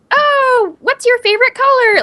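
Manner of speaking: high-pitched mock child voice; little kid. Imitating preschoolers asking lame questions, little-kid voice. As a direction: ask it way up high like a small child. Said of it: "little kid questions".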